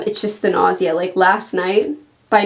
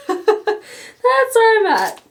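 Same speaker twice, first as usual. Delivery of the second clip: laughing; 12 semitones higher, much slower than usual. Real, not acted. Laughing off her nausea.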